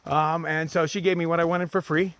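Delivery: normal talking voice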